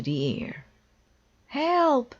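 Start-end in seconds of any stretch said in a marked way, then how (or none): 1.5-2.1 high, loud cry for help; frantic high call for help; panicked cry. A narrator's reading. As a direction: yell it out as the list flies away. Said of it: "cried Toad".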